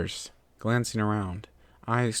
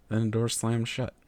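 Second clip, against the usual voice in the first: plain reading voice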